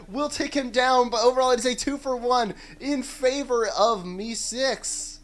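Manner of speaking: rising hype as the fight breaks; excited play-by-play. Real, not acted. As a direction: call the fight, high and loud. Live teamfight, caster calling action.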